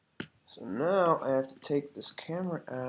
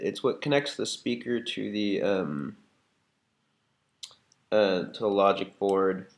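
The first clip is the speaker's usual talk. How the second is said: ordinary delivery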